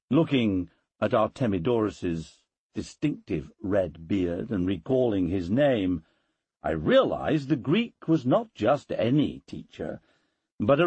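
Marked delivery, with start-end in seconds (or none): none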